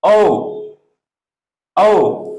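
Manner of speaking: ओ said cleanly and loud as a long pure o; pronunciation demo. Shown as a demonstration. Teacher to Hindi pupils, careful loud ओ. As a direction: model ओ for the class. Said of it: "इसको हम बोलते हैं".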